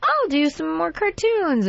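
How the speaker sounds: high, slow cartoon character voice; sing-song cartoon kid voice. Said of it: "I'm like".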